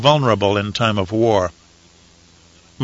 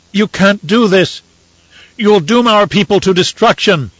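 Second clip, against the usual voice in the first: high, loud, slowed protest; shouted; 8 semitones higher, 4 dB louder than usual. Acted. Messenger shouting at the Emperor, desperate. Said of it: "Yuta Bray shouted at Elrude".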